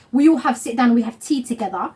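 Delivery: ordinary talk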